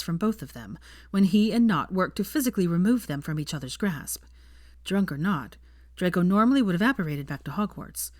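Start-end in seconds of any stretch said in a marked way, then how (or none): none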